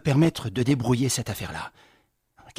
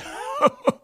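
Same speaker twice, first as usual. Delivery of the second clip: rire aigu et éclatant; gaieté formidable; grand éclat de rire. Acted. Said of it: "éclats de rire". (Patrice éclate de rire, aigu)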